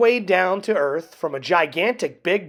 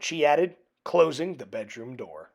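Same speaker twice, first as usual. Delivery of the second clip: ordinary delivery, nothing marked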